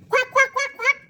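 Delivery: high, loud quacking; shouted quacks. A narrator's reading. Richard shouts at the ducks, high loud quacks. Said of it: "shout Richard".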